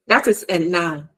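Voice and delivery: ordinary delivery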